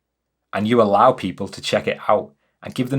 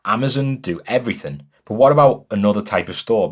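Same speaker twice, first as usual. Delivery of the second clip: ordinary talk, nothing marked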